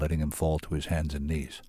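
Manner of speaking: ordinary delivery, nothing marked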